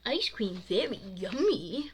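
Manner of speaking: put-on babyish voice with swooping tune; baby talk. A narrator's reading. Bo clowning for his two friends, baby talk. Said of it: "not allowed to talk like that".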